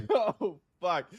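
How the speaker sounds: high laughing burst; laughing at a viewer's pun; real laughter. Real, not acted. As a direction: crack up at a chat joke. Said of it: "That was a good one".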